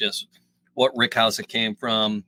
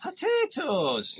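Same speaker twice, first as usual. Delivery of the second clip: drawn-out high voice; 14 semitones higher, 3 dB quieter, much slower than usual. Pretend. Mock reaction to chip.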